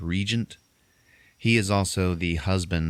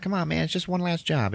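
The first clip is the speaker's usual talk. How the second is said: higher pleading coax; high mock coaxing; 10 semitones higher than usual. Partner coaxing Willie into one last job, high mock coaxing. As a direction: wheedle in a pitched-up voice.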